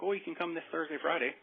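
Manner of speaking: a hint of a breezy receptionist; mock office staff. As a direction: imitate the surgeon's people, a touch chirpy. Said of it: "So they're like".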